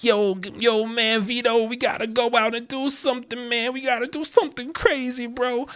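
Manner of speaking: put-on high-pitched, excited young guy; eager young hothead. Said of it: "one of those young people being like".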